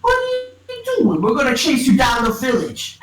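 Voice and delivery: high squeal settling into threat; mock biker threat. Biker guys threaten to chase them, mock menace. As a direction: start way up high, threatening playfully.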